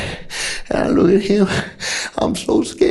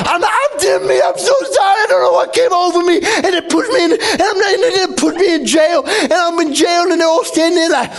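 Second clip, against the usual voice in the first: panicked pleading; 8 semitones higher than usual. Acted. Pleading with police after the killing, high panicked wail. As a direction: beg in tearful panic.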